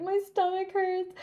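high, lightly tearful complaint; mock crying whine. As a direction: imitate yourself whimpering in pain. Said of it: "I was crying that mile a little bit".

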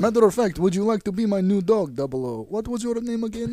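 playful Russian captor's offer; comic Russian-accented villain; mock Russian accent. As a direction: taunt the prisoner in a Russian accent. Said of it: "now you have Russian accent".

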